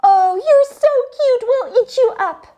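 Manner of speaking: high, loud cooing relative voice; doting relatives. Retold or imitated. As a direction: coo over a child like a gushing aunt. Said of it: "and were saying".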